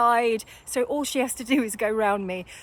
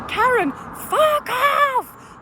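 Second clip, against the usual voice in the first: high, drawn-out furious shout; scream; 12 semitones higher, 5 dB louder, much slower than usual. Retold or imitated. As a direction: re-enact screaming someone away, stretching the words. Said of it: "And I just screamed it".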